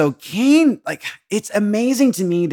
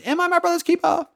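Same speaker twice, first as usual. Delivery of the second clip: very high mock theatrical voice; hammed-up famous quote. Quoting the line everyone knows, mockingly hammed up. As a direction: squeal it in an exaggerated falsetto.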